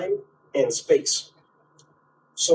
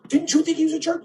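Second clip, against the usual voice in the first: high, excited gossip voice, pitched way up; gossipy complaining friend; 13 semitones higher, faster than usual. Retold or imitated. Angry gossip ringing a friend about a jerk, high and indignant. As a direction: stir up friends against him.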